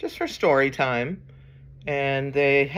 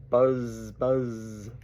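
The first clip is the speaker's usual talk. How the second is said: drawn-out buzzing Z, dropping away; bee buzz; 5 semitones lower, 3 dB quieter than usual. Acted. Reader inviting children to go buzz, bee imitation. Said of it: "He can sound like a bee".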